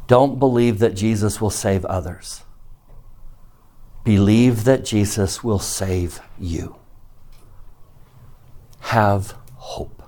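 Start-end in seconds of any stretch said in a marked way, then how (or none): 4.0-6.8 slow, spaced delivery setting "you" apart at the end; slow down and land deliberately on "you"; measured, sincere weight on "you"; stress on YOU. Shown as a demonstration. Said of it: "Emphasis on what word?". 8.8-10.0 soft, slow, near-whispered encouragement; quiet, spaced-out plea; hushed appeal. Real, not acted. Earnest appeal after the lesson.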